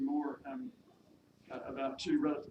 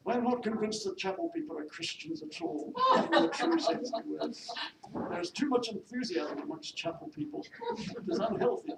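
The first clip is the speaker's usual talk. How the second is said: haughty, high-pitched pronouncements; disapproving mistress; 8 semitones higher, 7 dB louder than usual. Acted. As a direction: lay down the law as a snobbish lady. Mistress disparages chapel people.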